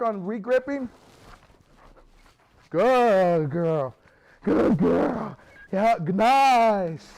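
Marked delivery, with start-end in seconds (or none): 2.7-7.1 encouraging praise to the dog. Real, not acted. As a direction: trainer praising his pup during tug play. Praising the dog mid-tug.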